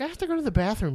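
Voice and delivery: high-pitched child imitation; little kid voice. Young son asking dad at football game, high kid voice. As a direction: pipe up like a small boy.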